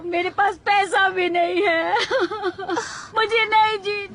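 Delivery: hammed-up sobbing lament; tearful wailing. Broke boss crying to her employee, wailing. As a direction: wail through big sobs. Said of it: "पृथ्वीवासी के आंसुओं का मतलब".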